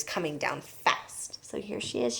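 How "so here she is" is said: whispered aside. Real, not acted. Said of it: "reading aside".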